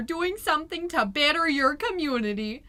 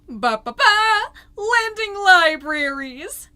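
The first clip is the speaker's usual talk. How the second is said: high, loud and slow sing-song; mock fanfare; 6 semitones higher, 9 dB louder, slower than usual. Mom unveiling the lending libraries, loud drawn-out fanfare. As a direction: trumpet it out like a big reveal.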